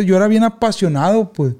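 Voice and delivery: usual speaking voice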